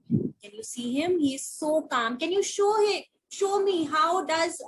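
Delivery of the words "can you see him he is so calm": ordinary talk, nothing marked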